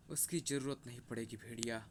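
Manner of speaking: low, soft, controlled answer; restrained reply. Acted. Chief repeats answer to wolf-god. (दबी, नीची आवाज़ में)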